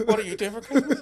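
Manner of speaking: high, laughing imitation of forced small talk; mock small talk. Guest imitates their own stalled chat, laughing. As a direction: mimic the awkward Christmas question.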